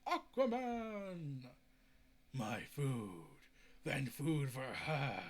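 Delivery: low, slow, growled creature calling for food; lightly hammed sea monster voice. Trench creature hissing to Aquaman about food, in a slow low creature voice.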